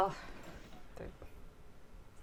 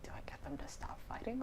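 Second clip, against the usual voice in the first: whispering; 4 semitones higher, 15 dB quieter, faster than usual. Real, not acted. Musing under breath.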